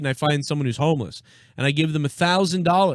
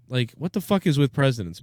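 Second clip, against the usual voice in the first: normal talking voice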